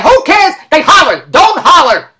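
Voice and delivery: high, loud dismissal; exasperated retort. Retold or imitated. Daughter cutting off the agency's question, exasperated. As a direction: shut it down in mock exasperation.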